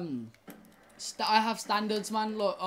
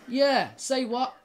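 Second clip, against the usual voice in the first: taunting challenge; 4 semitones higher, 6 dB louder, slower than usual. Real, not acted. Taunting opponent during gunfight.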